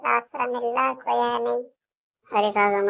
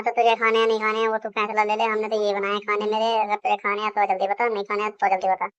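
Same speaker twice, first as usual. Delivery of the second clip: ordinary talk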